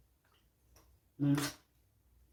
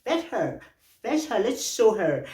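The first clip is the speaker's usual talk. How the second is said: much higher, slow mocking cheer; lightly voiced friend urging, higher; mock egging on; 10 semitones higher, 4 dB louder, slower than usual. Friend cheering on the fight, high mock egging. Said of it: "my friend were like".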